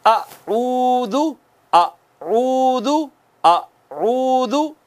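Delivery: अऊदु drawn out slowly, lips rounded on the u; slow tajweed chant of अऊदु with rounded lips; Quranic recitation. Shown as a demonstration. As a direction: recite अऊदु slowly, stretching the first vowel. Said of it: "आपने यूं पढ़ना है".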